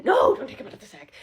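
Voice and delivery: loud, insistent warning; urgent loud no. Real, not acted. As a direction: stop him sharply. Urgently warning about ferret.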